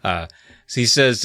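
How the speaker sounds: ordinary talk, nothing marked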